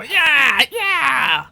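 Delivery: high, shrieked goblin war cheer; loud, high goblin yells. Acted. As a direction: shout it like a riled-up goblin mob. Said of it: "some of the goblins behind him go".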